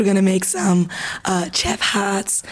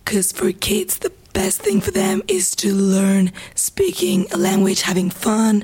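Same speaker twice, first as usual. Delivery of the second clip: hoarse, half-whispered, strained voice; losing her voice. Real, not acted. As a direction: push words out through a worn-out voice. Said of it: "Sorry for my voice".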